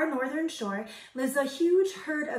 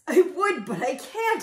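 high, hammed-up sobbing voice; 6 semitones higher, 7 dB louder than usual. A narrator's reading. Moose pleads with his friends, sobbing. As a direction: wail it as a mock sob. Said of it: "sobbed poor Thidwick".

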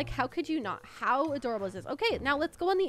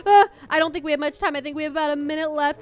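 hurried panic; 3 semitones higher, 10 dB louder, faster than usual. Real, not acted. Timer running out.

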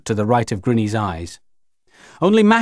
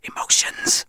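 urgent whispered hiss; 5 dB quieter than usual. A narrator's reading. Child rallies the others against Grinny, urgent whispered hiss. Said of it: "I hissed".

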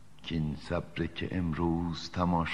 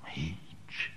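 breathy hush of a single word, voice dropped away; whispered answer; 11 dB quieter, much slower than usual. Acted. Poet answering his own question in a resigned whisper. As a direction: let the word fall to a bare whisper.

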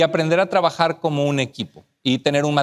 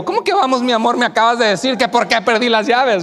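much higher and louder, incredulous; mock outrage, voicing the wife; indignant wife; 4 semitones higher, 4 dB louder than usual. Wife to husband who just scolded her, incredulous.

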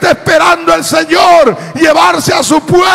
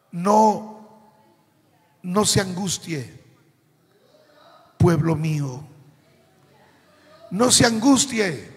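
tender reassurance; 3 semitones lower than usual. Retold or imitated. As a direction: quote it as gentle comfort to a beloved people. Cita consoladora de Jesús, pausada. (consuelo tierno en voz baja)

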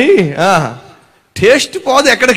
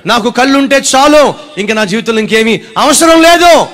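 emphatic plea; 4 semitones higher, 6 dB louder than usual. Shown as a demonstration. Preacher to congregation, loud emphatic plea. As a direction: drive the plea home. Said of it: "జాగ్రత్తగా వినాలి".

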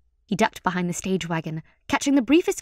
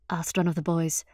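ordinary delivery

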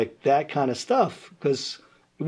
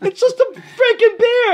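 very high, loud, slow, laughing wail; laughing outraged plea; exasperated protest; 9 dB louder than usual. Retold or imitated. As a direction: whine through laughter. Arguing with bouncer.

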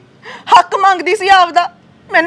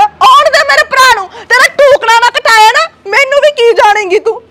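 higher, louder threatening shout; angry threat; 5 semitones higher, 3 dB louder than usual. Acted. Sister shouts a threat at her sister-in-law, angry.

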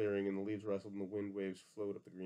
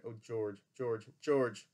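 calling out, building to a louder cry; 6 dB louder, much slower than usual. A narrator's reading. Lenny calling for George as the rabbit taunts, crying out. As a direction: cry the name, growing louder each time. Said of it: "and he cried".

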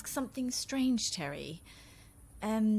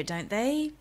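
ordinary delivery

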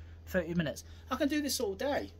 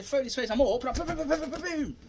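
loud, rapid boom boom punch noises; excited fight sounds, boom after boom; punching sound effects; 3 semitones higher, 5 dB louder than usual. Shown as a demonstration. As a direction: hammer out the hits with your voice.

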